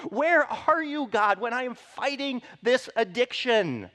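loud, high pleading cry; anguished cry to God. Acted. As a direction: cry out Where are you. Voicing anguished cries to God.

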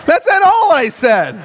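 high, shouted confession; comic outburst, voice way up; emphatic denial. Real, not acted. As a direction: protest loudly for laughs. Comic confession after saintly version.

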